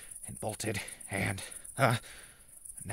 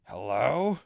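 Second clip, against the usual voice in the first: ordinary talk, nothing marked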